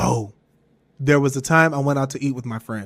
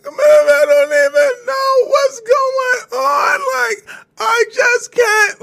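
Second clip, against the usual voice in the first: loud, very high, drawn-out sobbing; mock crying wail; 5 dB louder, much slower than usual. Breaking down to mom in the car, hammed-up crying. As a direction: wail it slowly through exaggerated sobs. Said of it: "I just couldn't stop crying".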